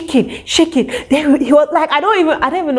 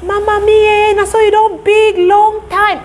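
high, loud, dragged-out pidgin cry of welcome; hammed-up overjoyed stranger, much higher; loud theatrical greeting from a stranger; scammer's exaggerated greeting; 13 semitones higher, 3 dB louder, slower than usual. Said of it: "the guy was like".

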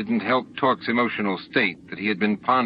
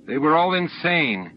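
higher-pitched insistent justification; raised, protesting answer; defensive retort; 7 semitones higher than usual. A narrator's reading. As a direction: insist on your reason, defending yourself. Defensive answer in a heated quarrel.

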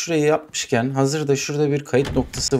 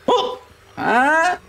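startled yelp. Real, not acted. Startled in horror game.

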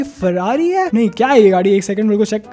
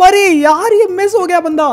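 higher and louder groan of a miss; frustrated shout; 7 semitones higher, 5 dB louder than usual. Real, not acted. Gamer missing his target, loud frustrated shout. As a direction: yell in disbelief. Just missed hitting rival.

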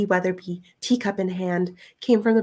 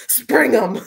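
higher, louder hammed-up call; mock command; 5 semitones higher, 7 dB louder than usual. Reader joking about getting in a carriage, hammed-up shout. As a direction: playfully holler it like a carriage passenger. Jokingly repeats the coachman order.

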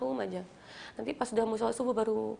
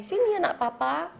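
louder, higher, slow call across the house; 8 semitones higher, 5 dB louder, slower than usual. Retold or imitated. Late father calls for his son on coming home, loud call. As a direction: call out fondly for the child.

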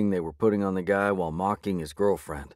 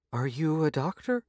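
high, puzzled woman's question; confused woman; 9 semitones higher than usual. A narrator's reading. Young woman asks the masked hero, confused. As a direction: ask unsure of him. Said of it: "she asked in confusion".

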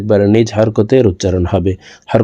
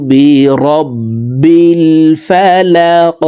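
high, slow, louder melodic chant; Quranic recitation; 7 semitones higher, 4 dB louder, much slower than usual. Shown as a demonstration. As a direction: recite the full verse for them to repeat. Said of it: "সম্পূর্ণ আয়াত বলে দিচ্ছি". (উঁচু সুরে ধীর তিলাওয়াত)

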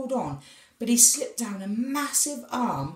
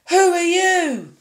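big, loud booming demand, much higher; booming giant; 10 semitones higher, 10 dB louder than usual. A narrator's reading. Giant booms at the boy who climbed in. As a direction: boom it out like a startled giant. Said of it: "he boomed".

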